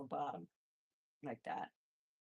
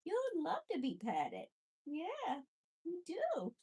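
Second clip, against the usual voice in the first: higher doting pet voice; 10 semitones higher, slower than usual. Real, not acted. Petting Buddy, cooing high baby talk. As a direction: sweet-talk him way up high. Cooing to her guinea pig.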